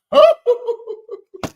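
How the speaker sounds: high-pitched cackle; real high laugh. Real, not acted. Laughing at chat.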